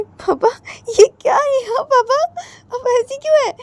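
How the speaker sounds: put-on very high, laughing child's voice; a little girl giggling at her papa. A narrator's reading. Little daughter teasing papa, high and giggly. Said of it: "हंसते बोलती है".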